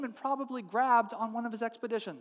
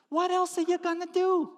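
high-pitched, pleading retort; exasperated wife; 10 semitones higher than usual. Acted. Wife pressing reluctant husband, exasperated. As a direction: argue back in a squeal.

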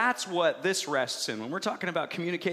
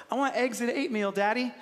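lightly voiced little girl, higher pitch; toddler daughter; 5 semitones higher than usual. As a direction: ask Daddy like a small child, "oatmeal" as "eight-meal". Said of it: "every morning she would go".

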